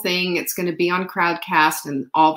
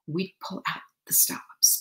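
whisper; 8 dB quieter than usual. Real, not acted. Teasing the secret gala plans.

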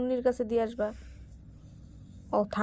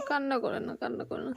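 soothing; 5 dB quieter than usual. Real, not acted. Mother soothing her crying toddler, soft and coaxing. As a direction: hush him gently, coaxing him over.

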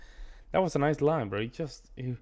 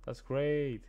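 ordinary talk, nothing marked

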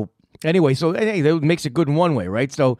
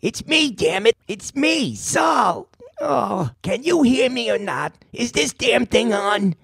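raised high voice into the phone; annoyed calling out; 8 semitones higher, slower than usual. Real, not acted. Host yelling into a dead phone line, annoyed. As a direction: shout to be heard on a bad line.

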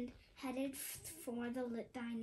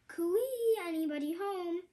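high, loud singsong call through the door; 5 semitones higher, 6 dB louder than usual. Acted. Visitor calling at Hen's door, a high cheery call. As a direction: sing out a cheery hello from the doorstep.

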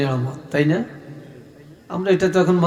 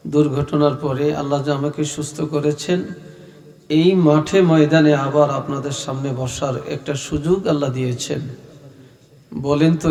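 ordinary talk, nothing marked

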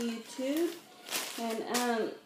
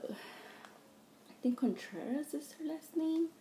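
whisper; 6 semitones higher, 5 dB quieter than usual. Real, not acted. Aside about a name.

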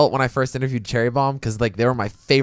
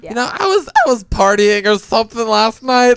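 high, drawn-out, cracking excuse; falsetto voice crack; 9 semitones higher, 4 dB louder than usual. Real, not acted. Excusing bad grammar after partying, voice cracking into falsetto. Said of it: "That voice crack was beautiful".

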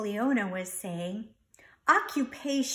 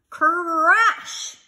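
loud, high crash cry; a loud, higher storybook crash; 7 semitones higher, 9 dB louder than usual. Acted. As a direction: shout the crash out for effect. Sound effect in the story.

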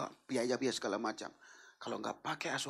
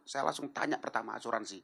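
ordinary talk, nothing marked

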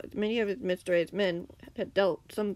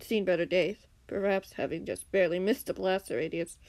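plain reading voice

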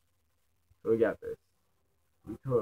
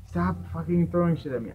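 annoyed yelling; 7 semitones higher, 6 dB louder than usual. Real, not acted. Enemies keep hitting the player.

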